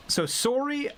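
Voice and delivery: "so sorry" high, Canadian SORE-ee; Canadian accent. Shown as a demonstration. Answering chat about Canadians, Canadian sorry. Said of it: "Canadians absolutely do".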